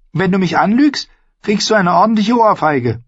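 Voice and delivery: put-on high-pitched girlish warning; threatening. Arya threatens Ned if he lies, high warning. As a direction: warn him sharply, pitched high.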